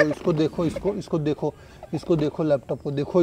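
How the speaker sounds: normal talking voice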